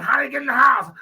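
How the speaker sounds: high, loud frantic question; panicked question. Retold or imitated. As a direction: demand it in alarm.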